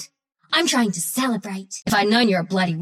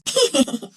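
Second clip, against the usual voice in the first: high giggle breaking into laughter; giggle, then laugh out in bursts; amused cackle; 4 semitones higher than usual. Acted. Mocking someone she has beaten, high cackling laughter.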